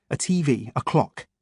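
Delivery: plain reading voice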